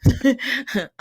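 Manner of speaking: laughing through the words; real laugh breaking in. Real, not acted. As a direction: giggle it out. Both hosts laughing at themselves.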